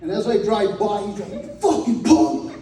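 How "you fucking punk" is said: high, drawn-out mock yell; retold angry insult. Friend cursing as he drives by, mock angry yell. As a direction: imitate an insult hurled at a passing car.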